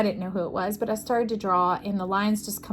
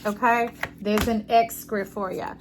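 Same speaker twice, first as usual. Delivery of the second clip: ordinary talk, nothing marked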